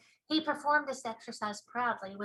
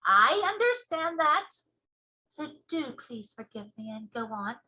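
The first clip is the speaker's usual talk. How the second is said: BFG giant voice; 5 dB louder than usual. Acted. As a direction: show off the ears proudly.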